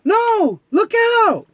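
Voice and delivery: high, loud cry of alarm; alarmed warning. Acted. Mickey warning as the tree is about to fall, alarmed. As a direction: yell a panicked warning.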